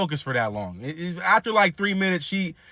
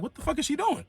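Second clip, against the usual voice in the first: high, soft incredulous question; baffled aside; 10 semitones higher, 6 dB quieter than usual. Retold or imitated. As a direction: wonder it to yourself, pitched way up. Baffled aside as grandma wanders off.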